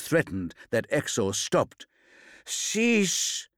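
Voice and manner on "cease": a single croaked word, pitched far higher. A narrator's reading. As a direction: gasp it out, weak and rasping. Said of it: "he croaked".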